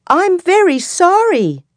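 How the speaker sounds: very high, squeaky little mouse apologizing; a tiny frightened mouse begging the lion. Acted. Scared mouse pleads with the angry lion, high and tiny. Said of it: "says the mouse".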